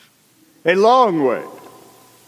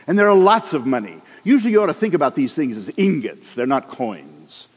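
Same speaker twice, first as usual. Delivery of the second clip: usual speaking voice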